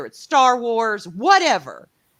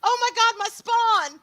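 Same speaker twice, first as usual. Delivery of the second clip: squealing delight; 12 semitones higher, 9 dB louder than usual. Real, not acted. Squealing over her child.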